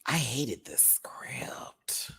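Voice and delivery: slow whispered confession. Real, not acted. Admitting she hated the script in a slow whisper. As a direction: whisper it slowly like a secret.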